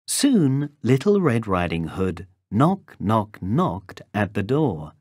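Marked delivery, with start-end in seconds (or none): none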